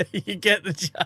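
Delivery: laughing. Real, not acted. Laughing at own joke.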